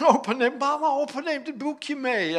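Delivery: high, loud imitated tattling; angry grandchild. Grandchild tells mama on teasing grandpa, angry and loud. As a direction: wail it to mama like an indignant small child. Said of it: "dan wordt ze op een gegeven moment boos".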